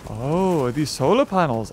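ordinary talk

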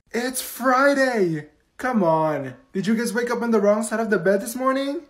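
normal talking voice

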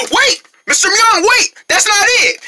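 gasp, then high-pitched calls; urgent plea. Acted. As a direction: beg him not to leave. Neighbor leaves, kids stop him.

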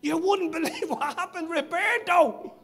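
high, loud imitated shout; excited pub news. Someone coming into the pub later to tell about Roberto, excited yell. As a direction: holler the news across a pub, stretching the name. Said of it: "when someone went into the pub later on".

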